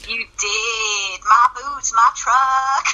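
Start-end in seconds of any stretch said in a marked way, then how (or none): 1.2-2.8 put-on Brit teasing an American southern accent; high, exaggerated southern twang; mock southern twang. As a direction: make fun of a southern drawl. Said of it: "a little southern twang".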